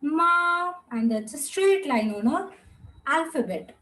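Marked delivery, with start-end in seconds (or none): 0.0-0.7 "ma" held high and level; first-tone "ma", sustained without rise or fall; flat first tone. Shown as a demonstration. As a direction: say "ma" on one high, unbroken flat pitch. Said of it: "Your voice goes flat".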